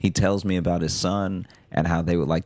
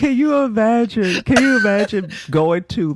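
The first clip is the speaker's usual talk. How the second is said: high, squealing through laughter; high, helpless with amusement, easing as it goes; cracking up; 5 dB louder than usual. Real, not acted. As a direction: barely get it out for laughing. Cracking up at the absurd story.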